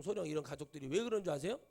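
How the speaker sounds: ordinary delivery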